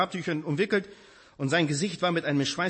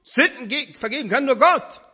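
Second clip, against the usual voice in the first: higher, louder outraged challenge; indignant scribes; 12 semitones higher, 8 dB louder than usual. Retold or imitated. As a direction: hurl it back as an accusing scribe. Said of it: "Und sofort sind die Schriftgelehrten da".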